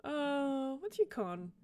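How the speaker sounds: usual speaking voice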